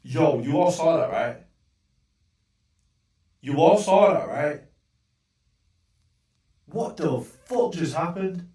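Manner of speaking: loud, incredulous appeal to the audience; exasperated shout. Real, not acted. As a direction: demand they back you up. Appealing to viewers after freeze.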